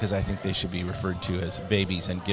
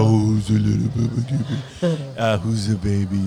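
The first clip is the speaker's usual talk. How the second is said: mock doting dog-owner coo, louder; gross baby talk to dogs; 4 dB louder than usual. Repulsed woman imitates owners talking to Scotty dogs, mock baby talk. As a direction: coo sickeningly over a pet, imitating its owner. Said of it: "talk about them in the most anthropomorphized, gross way".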